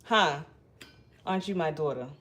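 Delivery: ordinary talk, nothing marked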